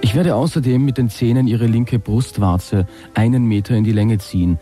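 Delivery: ordinary talk, nothing marked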